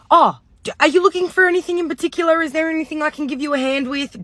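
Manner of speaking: high, fast, eager shop-assistant voice; pushy sales assistant. Retold or imitated. Imitating the pushy sales assistant who greets her at the door, high and fast. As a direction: chirp it like an overkeen salesgirl.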